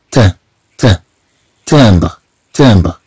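first ت alone with a nasal A, then تامبر whole; syllable demonstration. Shown as a demonstration. Teacher shows learners the sound, syllable by syllable. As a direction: build تامبر up from its first syllable, pausing between. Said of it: "إذا نقول".

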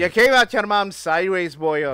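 loud, hammed-up Scottish accent. Shown as a demonstration. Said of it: "He says it in a Scottish accent".

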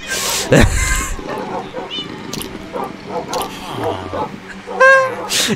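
long high-pitched giggling fit; squeaky high laugh. Real, not acted.

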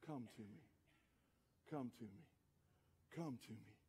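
very soft, low, gentle call; Jesus. Acted. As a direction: invite tenderly, pausing after each call. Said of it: "Jesus is calling".

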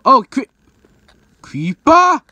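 high, loud exclamation, last word drawn out; mock alarmed cry. Told a creeper is behind, crying out high and loud.

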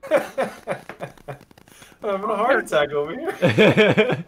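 high, loud laughing panic; nervous laughing. Real, not acted. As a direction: laugh through the nerves.

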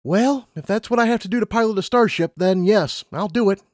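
put-on high, eager young mare; Lumina's voice. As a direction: voice her up high, keen and bright-eyed.

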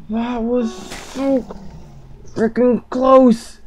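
high, strained whine, slowing down; whimpering distress. Real, not acted. Gamer whining to the enemy who hurt his dog, distressed. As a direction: whimper through the near miss.

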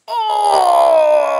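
ordinary talk, nothing marked

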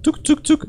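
high, quick "tuk" noises; tap-tap noises made by mouth; imitated tapping. Player inspecting an object, imitated tapping.

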